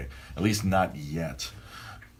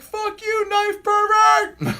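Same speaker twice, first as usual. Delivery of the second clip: screaming heckle, much higher and louder, dragged out; top-of-lungs heckle; 7 dB louder, much slower than usual. Retold or imitated. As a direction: roar it at the wrestler like a rowdy fan. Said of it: "at the top of my lungs".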